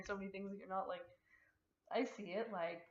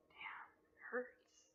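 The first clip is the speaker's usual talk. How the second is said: softer, whispered aside; whispered complaint; 3 semitones higher, 9 dB quieter, much slower than usual. Real, not acted. Guest whispers a swear to host, hushed. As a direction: whisper it so the mic barely hears.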